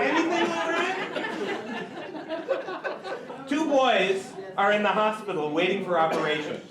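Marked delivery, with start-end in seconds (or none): 0.0-2.3 high, drawn-out punchline question; acted waiter. Waiter checking on table of women, slow high mock question. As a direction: stretch it out slowly, pitched up high.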